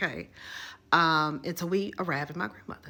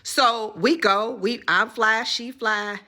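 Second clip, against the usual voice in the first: excited; 5 semitones higher, 9 dB louder, slower than usual. Real, not acted. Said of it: "excited rush to concert".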